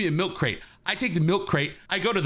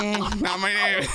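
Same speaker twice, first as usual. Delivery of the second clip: lightly strained voice; 4 semitones higher, slower than usual. Retold or imitated. Tony's silent thought voiced.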